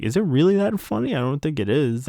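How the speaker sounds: a retold, incredulous Gray; mock baffled. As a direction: pitch it up in disbelief. Gray asked why it's funny.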